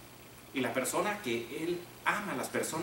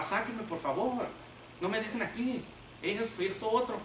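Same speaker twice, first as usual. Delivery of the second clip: desperate plea; 7 semitones higher than usual. Acted. Joseph in the pit begging his brothers, high pleading cry. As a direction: beg them to pull you out. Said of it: "él les gritaba".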